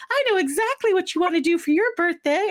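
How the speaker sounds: ordinary talk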